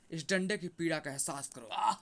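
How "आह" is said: loud acted "aah" of pain; cry of pain. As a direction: yelp as if struck by a stick. Struck with the stick, cries out. (दर्द भरी आह)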